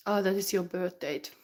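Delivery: ordinary talk, nothing marked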